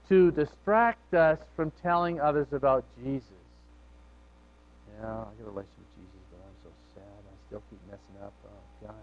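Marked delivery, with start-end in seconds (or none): 5.0-8.9 mock despair, much softer; a half-whispered, dejected lament; sad believer. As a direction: sigh it out as a downcast believer. Discouraged believer voiced after sadness talk.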